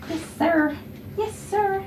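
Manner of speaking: high, soft pet talk; cooing to a dog. Real, not acted. Groomer praising the dog on her table, high cooing. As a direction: coo it up high to a pup.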